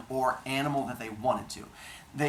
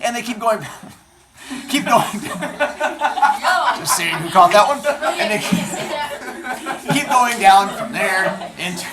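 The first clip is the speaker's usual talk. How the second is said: real laughter breaking through the words, louder; laughing; 8 semitones higher, 11 dB louder than usual. Real, not acted. Teacher cracking up at her own joke, laughing through the words. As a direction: giggle helplessly while pushing on with the story.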